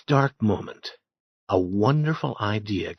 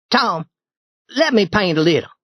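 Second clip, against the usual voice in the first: put-on eager asking boy; 8 semitones higher, 4 dB louder than usual. Ben asks to paint.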